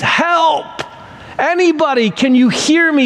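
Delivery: high-pitched acted cry for help; yelling for help. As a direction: call up as if stranded, pitched high. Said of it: "begins to yell for help".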